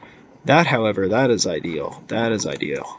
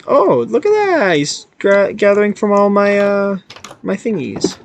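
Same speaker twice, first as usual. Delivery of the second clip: high, delighted exclamation; excited surprise; 8 semitones higher than usual. Real, not acted. Player spots something gathering from his things, excited surprise. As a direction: exclaim in a sudden high pitch.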